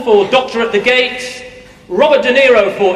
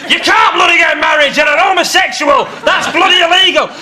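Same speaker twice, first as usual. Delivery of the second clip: angry scolding; 6 semitones higher than usual. Acted. Flatmate Brian scolding Nathan.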